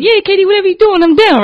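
high, loud, quick yes; eager agreement. Retold or imitated. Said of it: "I'm used to him saying".

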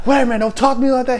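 quick, high-pitched objection; mock indignant protest. Civilian objecting to the threat, mock indignation. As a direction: protest fast in an imitated high voice.

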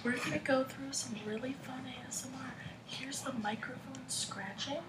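very hushed, unvoiced whisper; ASMR whisper. Shown as a demonstration. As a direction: whisper like a channel host greeting viewers. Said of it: "what you gotta do is you gotta go".

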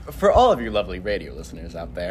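low smooth radio host voice; deep mock announcer read. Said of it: "do my radio announcer voice".

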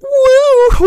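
very high, loud mock whoop; whooping cheer. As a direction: cheer like lifting weights overhead. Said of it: "and I go".

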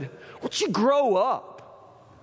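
slow, contemptuous put-down; scornful Jezebel. Retold or imitated. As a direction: sneer it down at a weak husband slowly. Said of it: "This is how she talks".

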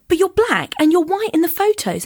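high-pitched retold disbelief; shocked. Confronting the date about his photos, shocked. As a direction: protest the mismatch, pitched well up. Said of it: "I'm quite shocked".

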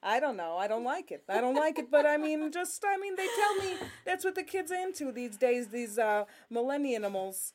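fretting New Jersey mom, pitch climbing; New Jersey lady. Acted. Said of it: "a lady from New Jersey".

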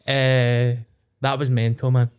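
ordinary talk, nothing marked

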